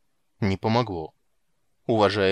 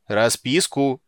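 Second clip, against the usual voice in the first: loud demand; 2 semitones higher, 6 dB louder, much slower than usual. A narrator's reading. Commandant shouts.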